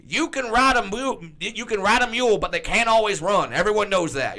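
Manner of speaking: high, loud folksy announcer. Acted. Commentator offering sayings that make no sense, emphatic mock folksiness. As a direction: proclaim it like Oklahoma wisdom. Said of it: "saying sayings from Oklahoma".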